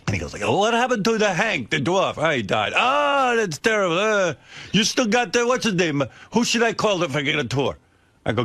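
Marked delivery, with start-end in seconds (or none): 0.4-7.8 put-on high, excited broken English in a thick Italian accent. Italian watch salesman quizzing a customer about his stylist, thick Italian accent. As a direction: imitate a fresh-off-the-boat Italian salesman, hammed up and high. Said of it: "he had some foreign accent".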